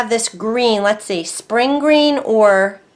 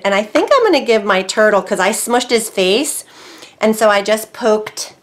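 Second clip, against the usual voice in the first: ordinary delivery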